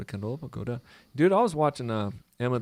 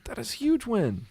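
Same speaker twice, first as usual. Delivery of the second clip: higher, excited; 10 semitones higher than usual. Real, not acted. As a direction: cheer the big trade win. Excited about winning trade.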